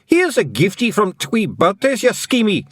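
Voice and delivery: high, loud Scottish feagle taunt; Scottish feagle yell. Acted. Feagle taunting Ratbag, loud high Scottish yell. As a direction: yell it at the cat in broad Scottish. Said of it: "he yelled".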